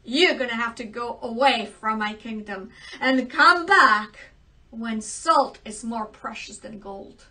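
loud, indignant royal scolding; angry king. Acted. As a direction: banish her in a huff. King angrily banishes his daughter.

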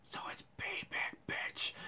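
soft, breathless whispered taunt; hushed mocking whisper. Real, not acted. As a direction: whisper it like a secret gloat. Teasing aside to absent person.

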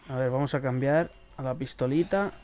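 normal talking voice

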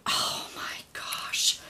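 breathless whisper of disbelief; hushed, stunned whisper; awed whisper. Real, not acted. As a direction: whisper it, too moved to speak. Overwhelmed by generous gift.

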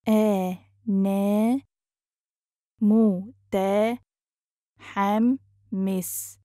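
very slow, broken into separate syllables. Shown as a demonstration. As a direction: sound it out with long gaps. Teacher breaks phrase into syllables.

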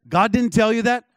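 high, loud retold disbelief; incredulous question. Startled guest pressing the prophet for an answer, incredulous. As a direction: question it in mock astonishment. Acting out his incredulous follow-up.

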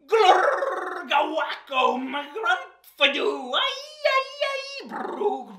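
silly nonsense sounds. Acted. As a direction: read the crazy sounds with gusto. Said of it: "read one last time the crazy sounds".

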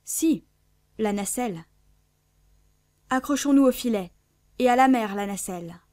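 ordres lancés très fort; voix énergique. A narrator's reading. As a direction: crier la manœuvre avec fermeté. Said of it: "non moins énergiques". (commandement sonore et résolu)

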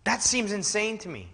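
high, loud, appalled emphasis; incredulous preaching. Real, not acted. Preacher appalled that Reformed pastors don't believe, incredulous outburst. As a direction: exclaim it from the pulpit in disbelief.

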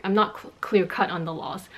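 ordinary delivery

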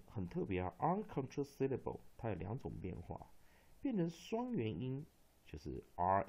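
ordinary talk, nothing marked

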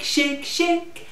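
ordinary delivery, nothing marked

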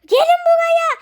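high, loud shout; urgent calling. Pretend. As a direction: shout them over in play panic. Urgently calling children over. (bağırarak çağırma)

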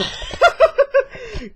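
loud, high burst of laughter. Real, not acted. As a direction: let out a big shouting laugh. Laughing at own video.

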